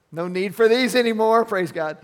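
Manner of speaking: joyful outburst. Real, not acted. Joyful exclamation about no tears.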